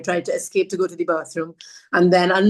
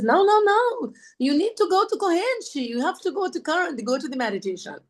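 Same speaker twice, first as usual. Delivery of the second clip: insistent high woman; 10 semitones higher than usual. Retold or imitated. Woman at the door urging the narrator to go to current, high and insistent. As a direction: press her to go, voice way up high.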